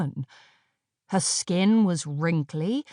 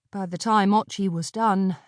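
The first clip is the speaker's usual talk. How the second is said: plain reading voice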